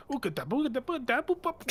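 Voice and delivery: high, babbling nonsense mutter; gibberish muttering. Acted. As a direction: babble it like a strange creature. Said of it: "you hear these mutterings".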